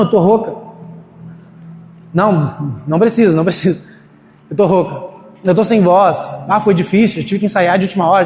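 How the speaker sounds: ordinary talk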